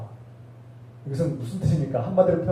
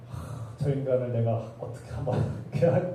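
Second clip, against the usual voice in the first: mock grumbling laugh; 5 semitones lower, 7 dB quieter than usual. Imitating grudging thought, laughs.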